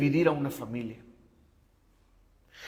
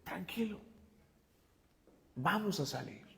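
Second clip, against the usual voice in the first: much softer, gentle calming words; wife calming husband after a setback, soft and reassuring; 2 semitones higher, 15 dB quieter than usual. Retold or imitated. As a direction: hush him like a wife soothing her husband. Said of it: "he escuchado su voz diciéndome".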